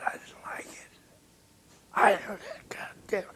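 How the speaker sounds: hushed whispered grumble. Retold or imitated. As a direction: breathe it out grudgingly. Retold reply to Miles.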